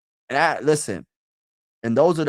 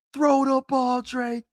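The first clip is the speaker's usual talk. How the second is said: high, mock stadium yell, drawn out slowly; retold scream; 11 semitones higher, slower than usual. Yelling at Drake May during the Super Bowl, high mock scream. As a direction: pitch it way up like yelling at the TV. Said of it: "I was screaming at the top of my lungs".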